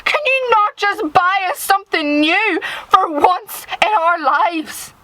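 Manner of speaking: acted tantrum, pleading and furious; acted teenage screaming. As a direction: rant at Ma like a fed-up teenager. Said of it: "screaming that I want a new coat".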